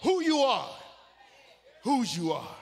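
full-force preaching. Acted. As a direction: preach it out to the rafters.

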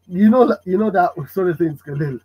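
ordinary talk, nothing marked

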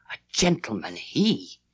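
low, slightly aghast question; a touch of disbelief, kept low; mild gasp of astonishment. A narrator's reading. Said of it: "Missus Grose gasped".

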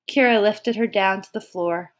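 ordinary delivery

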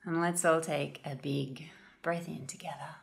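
calm, unhurried meditation guide with long pauses; guided meditation. Real, not acted. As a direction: gently guide listeners into noticing their breath.

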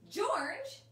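excited repeat. Shown as a demonstration. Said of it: "You're gonna say".

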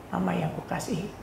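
unvoiced, soft whisper; hushed loving whisper. Real, not acted. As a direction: whisper it tenderly to her. Tender address to absent mother.

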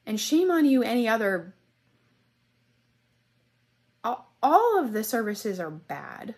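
a furious shout of shame at the hosting companies; outraged shout. Real, not acted. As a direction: denounce them at full volume. Calls out the company in anger.

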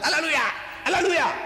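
high, quick cries of praise; high-pitched ecstatic exclamation; ecstatic shout. Retold or imitated. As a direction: imitate a worshipper shouting in ecstasy. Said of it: "they shout".

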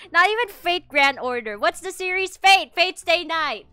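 loud, high and exasperated; flustered outburst. Real, not acted. Streamer telling viewers she doesn't know Fate, flustered outburst. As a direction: blurt it out at full volume. Flustered, groping for series name.

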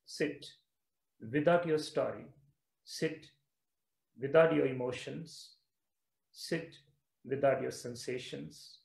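soft, low meditative instruction; guided meditation. Real, not acted. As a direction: guide them into stillness, softly, with long pauses. Guides listeners into stillness.

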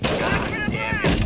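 slow drawn-out game-character curse; video game soldier. Retold or imitated. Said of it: "sounded like a Call of Duty player".

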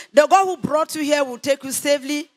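usual speaking voice

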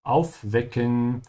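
Aufwecken said slowly, W as a V; German aufwecken. Shown as a demonstration. Teacher spelling out Aufwecken for learners, slow and careful. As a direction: sound out Aufwecken with a V. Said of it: "the W's in German are pronounced like a V".

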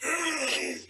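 long, rattling throat clear; disgusting throat clearing. Acted. Man hitting on a girl, gross throat clearing. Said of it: "clearing your throat is just disgusting".

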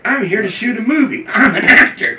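high, eager retelling of excitement; excited. Retold or imitated. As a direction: gush it like a thrilled newcomer. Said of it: "I was excited".

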